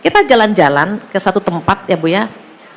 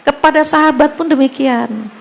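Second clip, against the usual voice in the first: usual speaking voice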